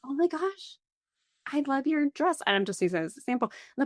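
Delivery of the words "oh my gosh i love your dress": gushing compliment. Retold or imitated. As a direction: pay an eager compliment in a high voice. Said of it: "I'll turn and be like".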